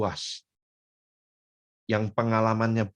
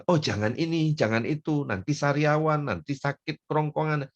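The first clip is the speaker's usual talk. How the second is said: fussy parent; 7 semitones higher than usual. Pretend.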